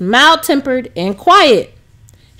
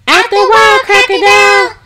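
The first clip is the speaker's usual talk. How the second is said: high, louder sing-song chorus; class chorus reply; 9 semitones higher, 5 dB louder than usual. A narrator's reading. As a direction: chant it back like a whole class. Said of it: "the class and Missus Fletcher replied together".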